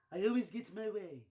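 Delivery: smug villain line; Mister Burns. Retold or imitated. Boss gloating to Kermit, smug Burns voice. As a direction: gloat it slyly. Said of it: "Mister Burns".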